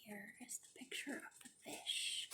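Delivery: hushed whispered aside; whispered picture aside. Real, not acted. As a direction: breathe it out like a secret. Quiet aside while showing pictures.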